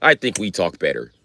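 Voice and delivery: normal talking voice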